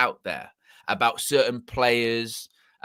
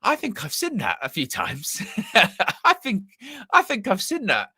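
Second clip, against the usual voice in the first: amused laughing realisation; 11 semitones higher than usual. Retold or imitated. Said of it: "I went".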